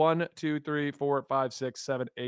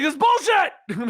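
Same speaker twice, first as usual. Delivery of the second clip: loud, high, slow indignant yell; angry outburst; 9 semitones higher, 7 dB louder, slower than usual. Retold or imitated. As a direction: explode at the cover in outrage. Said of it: "And I'm like".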